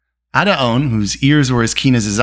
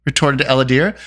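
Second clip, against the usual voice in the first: plain reading voice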